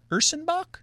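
Ursenbach with a steep high rising tone; unsure pronunciation. Real, not acted. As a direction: Ursenbach sounded out, high and questioning. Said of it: "Am I saying that right?".

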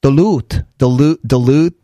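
"Duluth" in an imitated Minnesota accent. Shown as a demonstration. Host trying out Duluth, imitated Minnesota accent. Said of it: "how to pronounce it without the accent".

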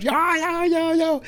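put-on high-pitched kids clamoring "me, me"; kids calling out. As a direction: squeal like eager children begging for a turn. Kids shouting to go first. (niños gritando "yo, yo", voz muy aguda)

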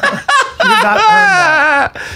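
laughing while scolding. Real, not acted. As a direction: laugh through the words. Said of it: "laughing through it".

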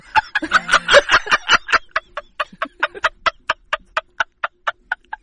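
loud high burst of laughter; long real belly laugh, tapering off. Real, not acted. Laughing at own joke.

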